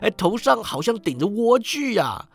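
high, lively exclamations; excited yelling. A narrator's reading. Scrooge points out the parrot, excited yelling. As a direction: rattle on in delighted wonder. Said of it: "史古基大叫".

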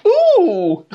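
high, loud camp "ooh"; mocking, hammed-up drag queen coo. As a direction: mimic an over-the-top camp swoop. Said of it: "it gets really camp".

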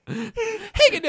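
high, slow, laughing shout; hammed-up dragon yell; mock dragon shout.

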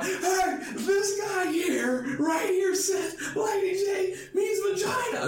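very high, drawn-out mock shout; imitated calling out. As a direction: holler it to the whole room in a high voice. Retelling guy calling out to others.

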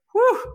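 high, quick exclaimed whew; impressed whew. Real, not acted. Host marvelling at the guest's federal prisoner past, impressed whew. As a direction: let out a high whew of amazement.

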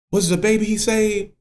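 high-pitched, awed question; stunned older woman. Retold or imitated. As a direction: imitate a woman gasping at a sudden realization. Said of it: "Holy Ghost meltdown".

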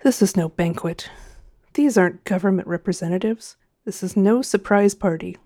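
plain reading voice

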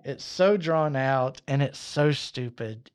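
ordinary talk, nothing marked

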